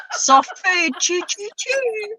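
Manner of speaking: mock carer cooing at old Neil; nursing home carer. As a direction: coo at an old man like a carer. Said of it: "what they're gonna say to him when he's an old man".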